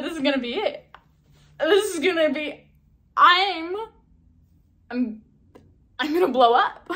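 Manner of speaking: excited. Retold or imitated. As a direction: build up to the big dream. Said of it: "I was so excited".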